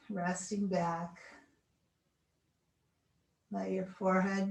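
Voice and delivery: slow, soothing guided relaxation. Acted. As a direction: talk them gently into stillness. Leads final relaxation.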